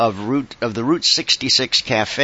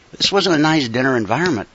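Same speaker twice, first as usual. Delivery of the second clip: normal talking voice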